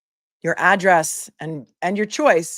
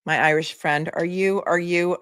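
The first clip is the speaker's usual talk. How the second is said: ordinary talk, nothing marked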